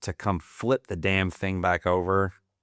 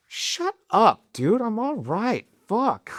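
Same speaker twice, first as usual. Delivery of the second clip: annoyed brush-off; 14 semitones higher, slower than usual. Retold or imitated. Annoyed retort to rescuer.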